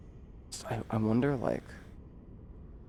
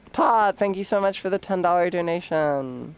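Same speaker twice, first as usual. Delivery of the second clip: higher airhead voice; bimbo voice; 7 semitones higher than usual. Acted. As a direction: coo it like a ditzy airhead. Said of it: "I'll do a bimbo voice for nine minutes".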